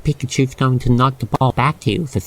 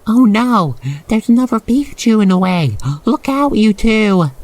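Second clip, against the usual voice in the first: high, louder sudden alarm; alarmed warning; 8 semitones higher, 4 dB louder than usual. Acted. Narrator warning the listener of a coming collision, alarmed call.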